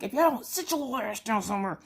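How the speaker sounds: high, tough mom threat; gangster voice. Retold or imitated. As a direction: lay down the law to the kids. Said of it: "I had to pull out the gangster voice".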